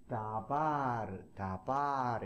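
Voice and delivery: '다바르'의 모음을 느리게 늘여서; '다바르'를 '다-바-르'처럼 길게 끌기. Shown as a demonstration. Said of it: "이렇게 길게 가는 거". ('다바르' 장모음으로 천천히)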